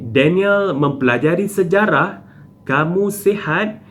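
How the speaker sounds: "sejarah" and "sihat" lifted as questions; question intonation. Shown as a demonstration. Teacher reading examples with question marks, question intonation on "sejarah" and "sihat". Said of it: "ayat penyata dengan tanda tanya pada akhir".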